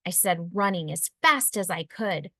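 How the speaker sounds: usual speaking voice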